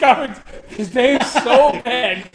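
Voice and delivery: slow words broken by a laugh; half-laughing, drawn out. Real, not acted. Laughing at his own story.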